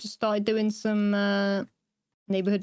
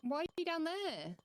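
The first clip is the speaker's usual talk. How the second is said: distant voice calling up from below; faint call from far off; calling from far away; 5 semitones higher, 10 dB quieter, slower than usual. Real, not acted. Friend answers from far below.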